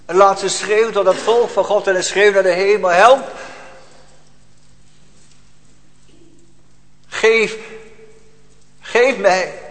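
loud, high desperate shout to heaven; anguished cry. Acted. (luide wanhoopskreet)

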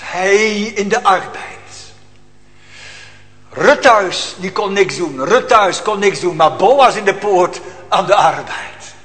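loud preaching, pressing the congregation; preacher warning the congregation about sin, loud and urgent; fiery preaching. Acted. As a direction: thunder it from the pulpit. Preaching at full force.

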